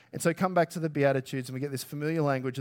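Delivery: normal talking voice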